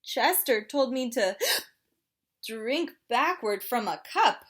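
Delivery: hiccupy little penguin, gasping; chatty penguin with hiccups; penguin character voice. Acted.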